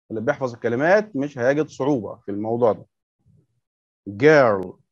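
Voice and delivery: ordinary talk, nothing marked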